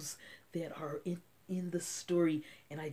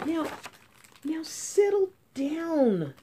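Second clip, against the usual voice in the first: sweet voice; 9 semitones higher, 3 dB louder than usual. Acted. Kind teacher sweetly calming her noisy class. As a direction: coax them sweetly to calm down. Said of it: "in a sweet voice".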